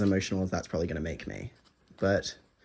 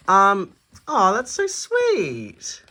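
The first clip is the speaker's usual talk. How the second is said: touched aww; 9 semitones higher, 7 dB louder, much slower than usual. Real, not acted. Touched by a gift card.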